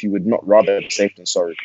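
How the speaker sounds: usual speaking voice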